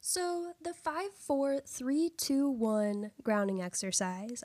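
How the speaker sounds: slow and deliberate, each number apart. Shown as a demonstration. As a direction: spell out every number slowly. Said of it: "I have to really slow myself down when I say that".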